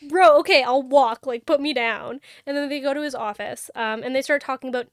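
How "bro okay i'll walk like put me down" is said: protesting. Retold or imitated. Picked up, protesting.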